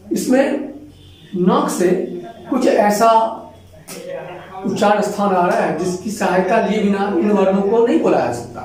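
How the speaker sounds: normal talking voice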